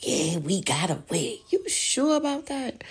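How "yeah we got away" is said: slow whispered imitation of them; mock whisper. Mocking the officials thinking they got away, whispered. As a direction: whisper it like smug plotters. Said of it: "And they like".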